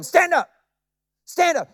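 high, forceful order to rise; retold command. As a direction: call it out high and firm. Jesus commanding the crippled man.

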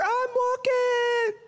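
high, slow, stretched-out wonder; amazed Peter. Acted. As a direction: squeal it with delight.

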